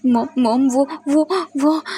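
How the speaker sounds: a scared son who cannot get words out; terrified stammering. A narrator's reading. Son clinging to his mother, too frightened to speak. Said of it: "कुछ भी अच्छे से बोल नहीं पा रहा था".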